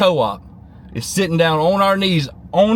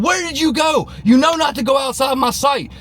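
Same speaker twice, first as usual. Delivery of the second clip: scolding shout; 7 semitones higher than usual. Retold or imitated. Mother scolding her son found by the cross, shouting. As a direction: snap at him, frightened and angry. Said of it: "She's going".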